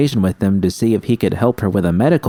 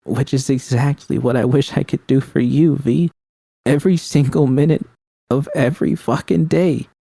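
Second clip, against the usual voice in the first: Judy pleading through tears, pitched higher; Judy, tearful anguish; 8 semitones higher than usual. Acted. Judy tells V she suffers just as badly, higher anguished plea. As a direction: voice Judy rising in helpless grief.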